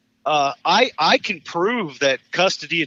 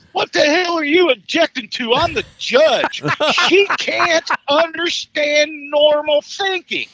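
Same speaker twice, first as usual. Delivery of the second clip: exasperated judge; 9 semitones higher, 4 dB louder than usual. Retold or imitated. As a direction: act the judge snapping at the attorney, slowing down at the end. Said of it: "he goes".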